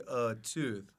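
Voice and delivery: normal talking voice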